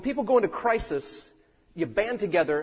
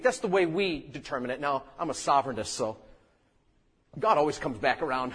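usual speaking voice

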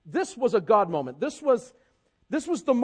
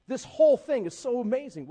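normal talking voice

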